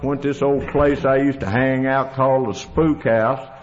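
ordinary talk, nothing marked

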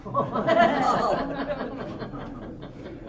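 high laughter at a punchline; amused laugh. Real, not acted. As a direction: laugh along at your own story. Laughs at her own anecdote.

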